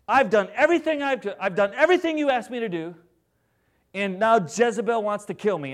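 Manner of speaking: high, loud, whining complaint; whiny pity party. Pretend. Elijah complaining to God, whining loudly. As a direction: wail it like a self-pitying prophet. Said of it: "Translation, um".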